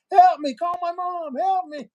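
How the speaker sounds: high, loud mock plea for help; retold cry for help. Retelling his desperate call from inside the jail, mock panicked. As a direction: call out for mom like you're scared, half-playing it. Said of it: "she could hear me".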